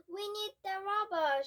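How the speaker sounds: loud and high; shout. Acted. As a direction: shout it across the street. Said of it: "shouted the woman".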